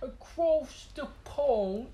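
high, very slow British imitation; mock British accent. Echoing co-host's joke, drawn-out mock British accent. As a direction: stretch out a silly British accent.

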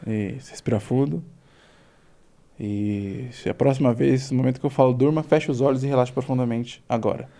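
hypnotic induction. Acted. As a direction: lull them down into trance.

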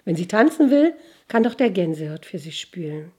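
ordinary delivery, nothing marked

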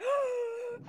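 high, drawn-in mock gasp; acted gasp of anticipation; excited gasp. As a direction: gasp with exaggerated delight. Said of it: "and you get so excited".

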